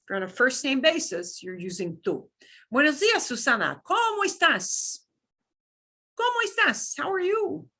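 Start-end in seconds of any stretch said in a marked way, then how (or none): 2.7-7.7 cheerful greeting. Pretend. Greeting Susana in a dialogue.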